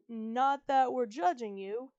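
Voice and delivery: ordinary delivery, nothing marked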